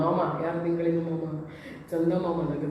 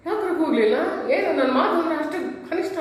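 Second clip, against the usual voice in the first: angry priest scolding; 8 semitones higher than usual. Retold or imitated. Priest scolds the silent crowd for not shouting, angrily. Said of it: "ಧರ್ಮಗುರುವಿಗೆ ಕೋಪ ಬಂತು".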